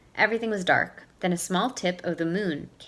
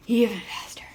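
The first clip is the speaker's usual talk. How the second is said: whisper; 3 semitones higher than usual. Acted. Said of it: "Owl urging himself on".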